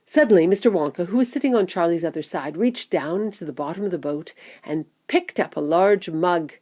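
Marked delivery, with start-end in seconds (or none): none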